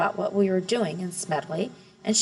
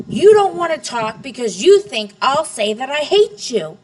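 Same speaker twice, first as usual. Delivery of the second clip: put-on loud, higher teenage outburst; yelling; 6 semitones higher, 9 dB louder than usual. A narrator's reading. Daughter yelling back at her father, loud and higher. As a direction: shout it right back at him. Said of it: "but then I yelled".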